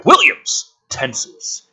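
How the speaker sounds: ordinary delivery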